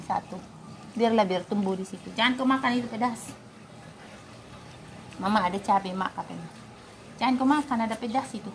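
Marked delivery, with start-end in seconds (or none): none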